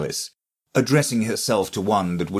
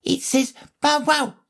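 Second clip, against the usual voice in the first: piping daisy. Acted. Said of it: "cried a daisy".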